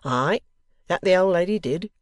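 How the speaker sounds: loud, hearty endorsement; proud husband chiming in loudly; stout pride. Acted. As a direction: back her up proudly and firmly. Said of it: "with stout pride in the circumstance".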